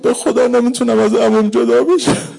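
put-on child's plea. Child refuses to leave uncle.

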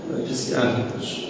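preacher repeating his point to the listeners in a rushed whisper; whispered aside. Acted. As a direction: drop to a quick hushed whisper. Same line repeated as aside.

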